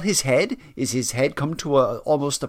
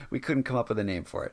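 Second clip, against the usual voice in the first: usual speaking voice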